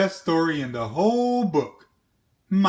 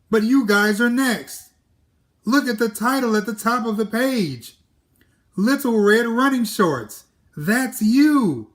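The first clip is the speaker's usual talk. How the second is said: excited; 7 semitones higher, 4 dB louder than usual. Acted. Jack urging Red, high pitch.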